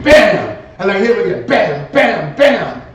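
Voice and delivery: building shouted bams; loud bams. Retold or imitated. Narrator reliving hitting the fudge with the bat, rising loud bams.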